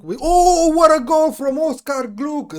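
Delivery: high ecstatic goal scream; excited shout. Real, not acted. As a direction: yell it like a last-minute winner. Goal celebration, yelling.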